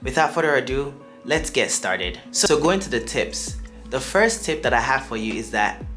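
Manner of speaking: ordinary delivery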